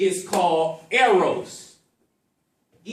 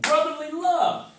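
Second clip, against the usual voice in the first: confirming; 8 semitones higher than usual. Real, not acted. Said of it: "That's actually the definition of it".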